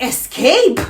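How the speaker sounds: loud, high disbelieving echo; shocked echo. Retold or imitated. Woman echoing the man's threat in loud disbelief. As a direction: throw the word back in shock.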